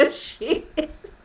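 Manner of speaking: high, loud, cracking up; laughing punchline. Retold or imitated. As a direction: burst out with it through laughter. Laughing retelling of husband's vouching joke.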